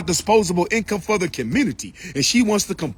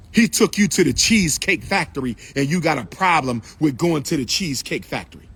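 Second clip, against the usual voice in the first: ordinary talk, nothing marked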